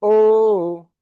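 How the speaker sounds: normal talking voice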